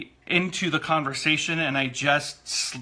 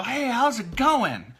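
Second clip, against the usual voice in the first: fake recognition; 8 semitones higher, slower than usual. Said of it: "make my eyebrows go up and go".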